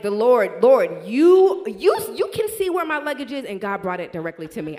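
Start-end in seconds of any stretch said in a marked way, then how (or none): 0.0-3.4 much louder, higher, overwhelmed cry to God; thanking God for finding the luggage, loud and ecstatic; ecstatic praise. Retold or imitated. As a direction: cry out to the Lord in joyful amazement. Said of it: "I'm like".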